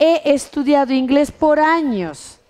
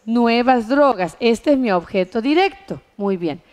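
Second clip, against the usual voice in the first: normal talking voice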